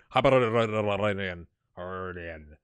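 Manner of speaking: Hibernian slurred into a long run of rolling syllables; playful garbled pronunciation. Pretend. Vlogger toying with a club name, playfully garbled. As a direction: roll and stretch "Hibernian" until it falls apart. Repeats name with exaggerated rolled sounds.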